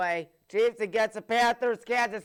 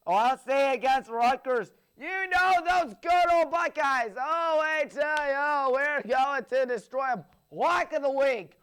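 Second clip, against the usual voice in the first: excited fan cheer; 5 semitones higher, 5 dB louder than usual. Real, not acted. Hyping his Buckeyes.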